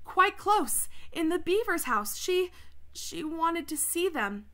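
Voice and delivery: put-on high-pitched, eager boy voice; Edmund's anxious report. A narrator's reading.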